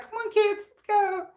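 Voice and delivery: put-on high mom voice hurrying kids along; mom-mode call to the kids; talking-to-kids voice. Shown as a demonstration. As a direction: lift the pitch and herd the kids out. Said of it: "they hear me talk like this".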